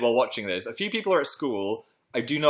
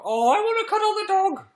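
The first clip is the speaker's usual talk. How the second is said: much higher, excited squeal over the dog; high dog-love squeal; 3 dB louder than usual. Real, not acted. To viewers, longing to cuddle a dog, high squeal. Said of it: "My voice has quite a high range when talking about dogs".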